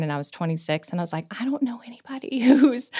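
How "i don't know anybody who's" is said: whispered aside. Retold or imitated. Retold isolation.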